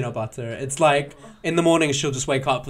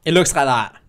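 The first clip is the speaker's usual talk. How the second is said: usual speaking voice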